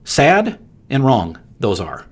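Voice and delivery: ordinary delivery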